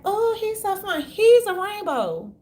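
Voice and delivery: mock adoring squeal, high and loud; mock gushing fan. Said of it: "they be like".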